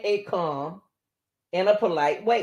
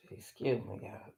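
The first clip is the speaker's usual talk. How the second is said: tired whisper; 8 semitones lower, 17 dB quieter than usual. Real, not acted.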